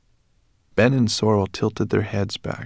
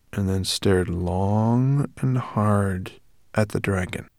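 ordinary delivery, nothing marked

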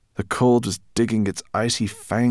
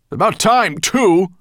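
impatient bark, high and loud; Nettlebrand's loud grunt; 13 semitones higher, 10 dB louder than usual. A narrator's reading. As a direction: grunt it out at full volume. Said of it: "grunted Nettlebrand".

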